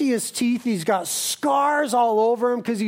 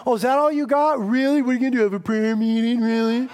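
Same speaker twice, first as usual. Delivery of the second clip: mild sneering devil voice; Satan mocking; faster than usual. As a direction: belittle the prayer meeting. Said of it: "he'll mock".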